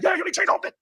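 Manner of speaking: high-pitched imitated outburst; excited mimicry. Retelling what the guys were like, high excited mimicry.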